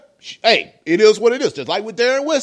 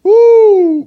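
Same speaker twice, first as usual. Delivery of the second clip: loud disbelieving whoop; 10 semitones higher, 9 dB louder than usual. Real, not acted. Exclaiming in disbelief.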